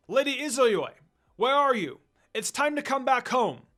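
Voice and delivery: high, loud call across the grounds; calling out loudly. A narrator's reading. Servant calling the princess home from afar, loud and high. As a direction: shout her name to bring her home. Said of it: "A servant called out her name loudly".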